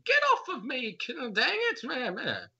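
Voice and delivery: high, strained character yell; strained scream. Acted. Game master voicing someone struggling in arms, strained high scream. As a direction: start high and strained, then ease down. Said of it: "You hear a strained voice scream".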